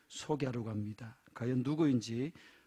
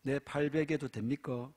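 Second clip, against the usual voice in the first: lightly played Gyeongsang dialect couple banter; a touch of Gyeongsang dialect, teasing; 3 dB quieter than usual. Preacher telling a dialect joke, in Gyeongsang dialect. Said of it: "경상도 여자".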